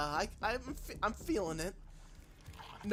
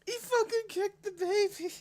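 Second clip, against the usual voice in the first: much higher and louder, squealing in disbelief; incredulous high exclaim; 5 dB louder than usual. Real, not acted. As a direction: squeal it out, unable to believe it. Disbelief at trailer moment.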